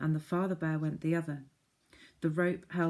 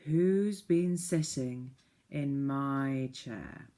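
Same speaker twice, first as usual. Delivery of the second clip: father bear; 2 semitones lower, much slower than usual. Acted. Father bear asking about his chair, slow and gruff. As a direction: growl it out slowly like a big bear. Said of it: "he said".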